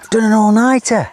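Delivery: put-on very high, gleeful kid voice; excited child. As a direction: chirp it like a proud child. Dad echoes proud daughter.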